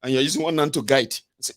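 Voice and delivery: ordinary talk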